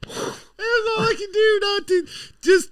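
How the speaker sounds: high, strained through laughter; barely holding back laughter; laughing helplessly. Real, not acted. Telling a friend about the girlfriend's coffee, laughing helplessly.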